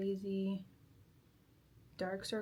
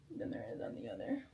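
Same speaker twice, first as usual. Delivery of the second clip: whisper; 3 semitones higher, 11 dB quieter than usual. Real, not acted. Muttering aside to herself.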